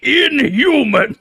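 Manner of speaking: high, slow, outraged bellow of Inhuman!; a fanatic marshal spotting an enemy; Garithos outburst. Retold or imitated. Garithos attacks the party on sight, outraged high yell. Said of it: "He's like".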